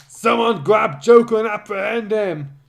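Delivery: loud, high shouted order; commanding shout. Acted. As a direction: bark the order to seize him. Character orders Joker's capture.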